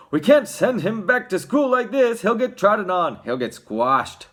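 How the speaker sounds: high, loud, protesting father; worried father. A narrator's reading. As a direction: argue it loudly in dismay.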